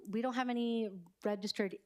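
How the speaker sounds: ordinary talk, nothing marked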